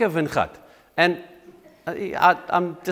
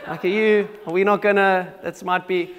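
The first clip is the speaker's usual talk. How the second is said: doubtful wife; 3 semitones higher than usual. Retold or imitated. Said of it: "she was like".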